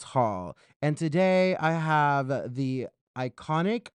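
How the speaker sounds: drawn-out, projected announcer delivery; show-host intro. Acted. Host introduces an eliminated queen to viewers, presenter intro. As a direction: present the guest grandly, stretching key words.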